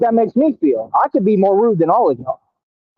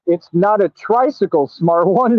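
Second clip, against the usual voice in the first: usual speaking voice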